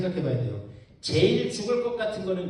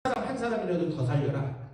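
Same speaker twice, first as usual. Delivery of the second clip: ordinary delivery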